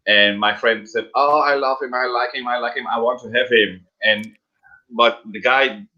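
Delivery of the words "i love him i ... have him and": ordinary talk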